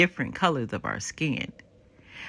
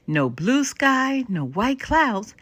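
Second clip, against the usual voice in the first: gentle guiding; 6 semitones higher, slower than usual. Acted. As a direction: lead the children through the image slowly. Grandmother guiding kids to imagine.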